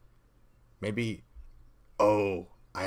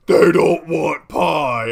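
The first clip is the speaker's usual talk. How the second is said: slow, high-pitched ogre bellow; angry ogre; 6 semitones higher, 14 dB louder, much slower than usual. Acted. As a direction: shout it out, stretching every word. Ogre character enraged before a fight.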